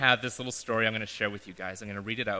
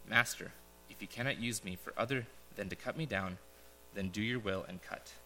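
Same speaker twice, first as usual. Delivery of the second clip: whisper; 3 semitones lower, 11 dB quieter than usual. A narrator's reading. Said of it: "There was a whisper".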